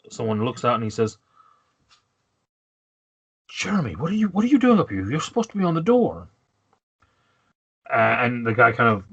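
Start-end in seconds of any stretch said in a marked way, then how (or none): 3.5-6.3 high-pitched, indignant guard; put-upon guard questioning a colleague, voice pitched up; startled door guard. Acted. Guard to colleague who left his post, high-pitched indignation.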